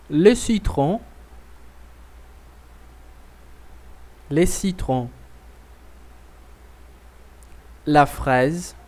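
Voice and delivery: each phrase said twice, clear and deliberate with a rising end; slow repeat-after-me drill. Shown as a demonstration. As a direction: model the sentence slowly so the class can echo it. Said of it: "você vai repetir agora comigo".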